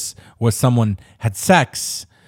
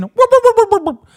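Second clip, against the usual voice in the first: high loud cartoon whoops; 8 dB louder than usual. Mario sound effects.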